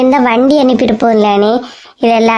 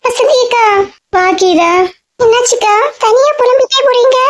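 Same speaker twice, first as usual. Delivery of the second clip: higher, lighter voice of a younger village woman; young Geetha; 9 semitones higher than usual. Acted. Geetha calls out to Vasanthi akka, higher eager voice.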